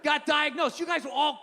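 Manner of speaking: ordinary talk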